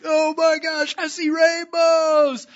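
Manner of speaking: very high and loud, words stretched; acted excited shriek. Telling her friend about rainbows, excited shriek. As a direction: squeal it out in amazement.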